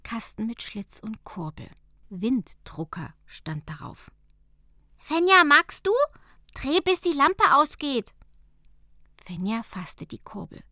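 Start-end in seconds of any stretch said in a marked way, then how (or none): 5.0-8.1 much higher, louder eager elf. Acted. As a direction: invite her to crank it. Mika invites Fenja. (Mika lädt Fenja fröhlich ein)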